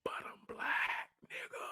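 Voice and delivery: whispered aside; breathy whispered punchline. Real, not acted. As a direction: hush it like a secret. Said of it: "whispered punchline again".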